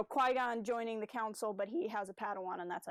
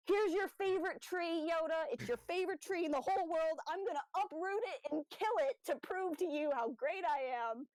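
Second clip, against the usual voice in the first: higher, lightly voiced boastful Dooku; mocking Dooku; 6 semitones higher than usual. Retelling Dooku's boast to Yoda for the co-host, mock higher voice. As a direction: show off like a smug apprentice, a touch higher. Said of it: "And then Dooku's like".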